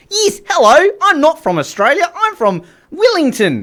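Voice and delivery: very high, hammed-up Kiwi; New Zealand accent. Shown as a demonstration. Host demonstrating accents for a listener, mock Kiwi accent. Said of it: "somebody who's a bit crazy sounds".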